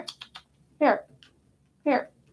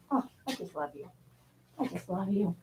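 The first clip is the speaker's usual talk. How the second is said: soft, lower loving words; tender; 6 semitones lower, 11 dB quieter than usual. Real, not acted. Owner hugging the pet, soft and tender. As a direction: murmur affection into the pet's fur.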